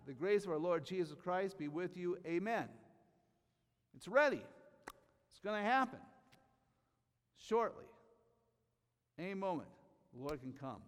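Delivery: high, loud, ringing proclamation; full-force preaching. Acted. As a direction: preach it out to the whole room. Preacher builds to a climax.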